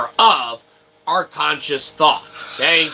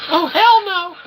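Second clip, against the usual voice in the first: squeaky voice; 5 dB louder than usual. Acted. High character voice reacts.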